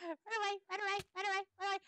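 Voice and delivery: high, squeaky mock-panicked chant. Player fleeing lava in the game, mock panic. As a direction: cry it out high as you pretend to flee.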